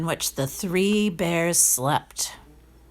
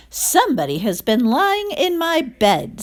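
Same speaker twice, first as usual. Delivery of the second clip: a touch higher, middling bear voice; lightly voiced mother bear, a bit louder; middle-sized bear; 7 semitones higher, 4 dB louder than usual. A narrator's reading. Middle bear notices her porridge, mild middle voice. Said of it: "in her middle voice".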